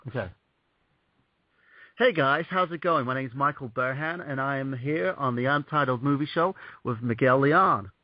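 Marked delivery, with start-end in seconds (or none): 2.0-7.9 put-on American accent, demonstrated; British speaker doing general American. Shown as a demonstration. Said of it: "I'm gonna do a general American accent now".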